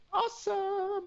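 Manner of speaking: very high, drawn-out mock kid voice; kids' catchphrase. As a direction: squeal it long and high like kids. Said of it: "as the kids like to say".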